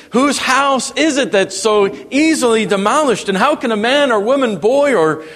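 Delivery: high, driving pulpit delivery; impassioned preaching. Real, not acted. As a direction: press the questions on the congregation with rising fervor. Preaches at full force.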